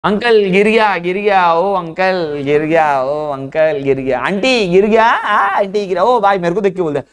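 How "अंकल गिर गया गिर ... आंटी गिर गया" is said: drawn-out street-seller chant, swooping in pitch; hawking vendor. Retold or imitated. Young seller calls to uncles and aunties in the market, sing-song hawking. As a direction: call out like a market hawker, stretching the words. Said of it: "जोर जोर से चिल्ला रहा है".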